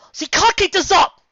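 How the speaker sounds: high, loud alarmed cry; worried shout. Acted. Worried cry during fight.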